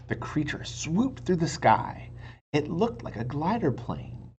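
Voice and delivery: ordinary delivery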